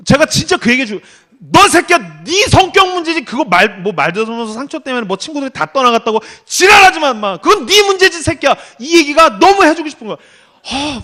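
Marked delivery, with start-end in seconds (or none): 1.4-8.6 high, loud imagined tirade; angry telling-off. Pretend. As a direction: lay into him with exasperated, raised-voice scolding. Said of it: "너무 화가 났어요". (화나서 쏘아붙이는 호통)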